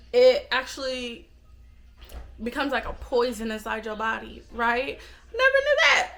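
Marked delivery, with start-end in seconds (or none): 5.3-6.1 surprised. Pretend. Mock surprised high squeal.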